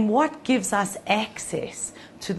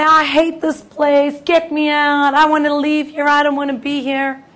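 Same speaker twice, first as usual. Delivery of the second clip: much higher, louder whining; whiny complaint; 7 semitones higher, 11 dB louder than usual. Pretend. All of us in exile complaining, high loud whine.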